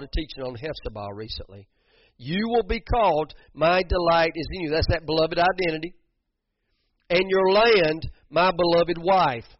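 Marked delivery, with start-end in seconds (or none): 2.2-9.5 fervent pulpit proclamation, stretched out; preaching at full force. Acted. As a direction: thunder the promise out to the church.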